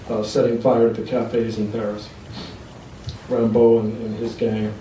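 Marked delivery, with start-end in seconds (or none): none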